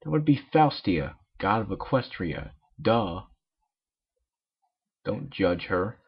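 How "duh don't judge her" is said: usual speaking voice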